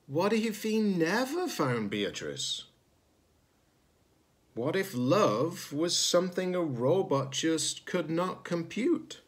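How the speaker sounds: usual speaking voice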